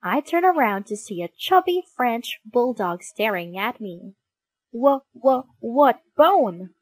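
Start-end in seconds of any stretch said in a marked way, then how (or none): 4.7-6.7 nervous, stuttered question, slightly louder; nervous stammer. Acted. Dog hiding his bone answers a stranger, stammering nervously. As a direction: stammer as if caught out. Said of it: "I ask him nervously".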